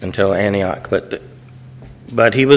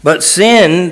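"sin" lifted high and held; stress on sin; 5 semitones higher, 4 dB louder than usual. Shown as a demonstration. Preacher rereading the verse after noting its emphasis, stress on "sin". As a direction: hit "sin" firmly as the verse resumes. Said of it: "this emphasis on the sin".

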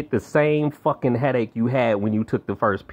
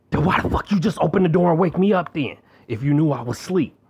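higher, exasperated comeback at mom; indignant retort; 5 semitones higher than usual. Retold or imitated. Talking back to mom about waking him, exasperated. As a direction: snap back at her, fed up.

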